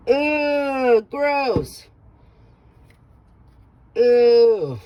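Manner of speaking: disgusted groan, then ew; grossed out and fed up; annoyed ugh. Real, not acted. Scraping off something gross.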